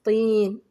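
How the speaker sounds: "طين" loud, with a long held vowel; stretched ya. Shown as a demonstration. Teacher showing pupils madd al-ya, stretched ya. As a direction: hold the "طي" of "طين" on a level note. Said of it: "هذا هو مد الياء".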